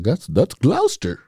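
put-on lightly posh English accent; imitating an English person saying the town. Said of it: "knowing English people".

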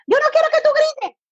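shouted high no-yelling order. Retold or imitated. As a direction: yell at the child to stop yelling. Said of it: "Pero estás gritando".

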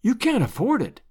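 high-pitched gentle objection; mild protest. A narrator's reading. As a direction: object softheartedly, pitch lifted. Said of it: "protested Aunt Sally".

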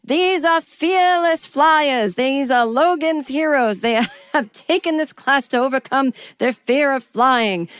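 high, slow, grand announcement; imitating a proud doctor presenting the group; mock proclamation. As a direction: proclaim it to the whole cabin. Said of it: "he is calling out to us".

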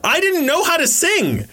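high-pitched, incredulous protest; comic protest. Acted. Kid cast as king despite not knowing how to sing, high protest.

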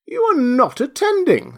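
very high, loud scolding squeak; severe. A narrator's reading. Mouse catching Alice daydreaming, severe. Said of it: "said the mouse to Alice severely".

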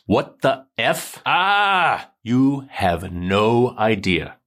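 slow, drawn-out exasperation with a sharp cry; mock exasperated text. Narrator voicing Sam's texts to Ellen, slow mock exasperation. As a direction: read the fiancé's texts like a fed-up boyfriend.

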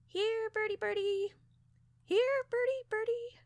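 very high sing-song pet call; falsetto, coaxing bird call. A narrator's reading. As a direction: coo up high to lure a birdie.

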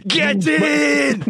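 high shouted London roadman hype; London roadman accent. Pretend. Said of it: "I'm on Top Boy right now".

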